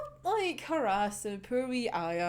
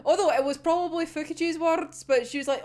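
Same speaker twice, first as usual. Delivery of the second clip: normal talking voice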